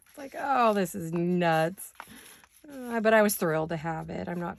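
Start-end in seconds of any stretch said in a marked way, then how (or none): 0.4-1.9 slow, drawn-out disbelief; mock-exasperated, laughing at the chaos. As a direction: stretch it out like it's all absurd. Running around in the rain.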